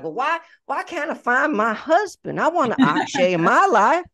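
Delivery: high mock Southern belle whine in a Southern accent; playful put-on Southern accent, pitched high. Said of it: "I don't know where this Southern accent just came from".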